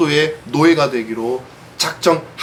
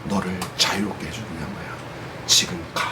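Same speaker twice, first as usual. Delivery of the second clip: softer and lower, gently telling her she is free; tender reassurance; 10 semitones lower, 8 dB quieter than usual. Acted. Gentleman softly telling the freed slave girl, tender. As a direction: say it like a kind man setting a child free.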